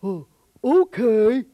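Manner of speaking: slow, loud dopey giant voice; a giant slowly giving in; dopey giant agreeing. Acted. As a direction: drag it out like a dim giant.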